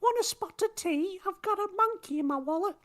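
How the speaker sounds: high, loud cartoon British accent; mock British accent. British podcaster mocking an American host, hammed-up British accent. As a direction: ham up a posh Brit offering tea. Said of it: "Is that a version of Nikki there?".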